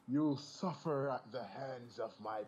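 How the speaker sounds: villainous threat. Acted. Sonic dot EXE thrusting his hand up, grand villain threat. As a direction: threaten grandly and slowly, hammed-up.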